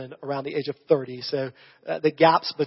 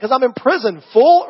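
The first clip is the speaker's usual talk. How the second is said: loud, high, scoffing comeback; mock indignant retort; 8 semitones higher, 10 dB louder than usual. Prisoner scoffing at Joseph, loud mock retort. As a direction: snap back like an annoyed inmate.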